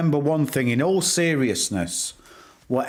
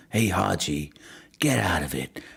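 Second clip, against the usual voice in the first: low mock urging; 7 semitones lower than usual. Urging a chatter named Haji, low and hushed. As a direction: hiss it low like a secret conspiracy.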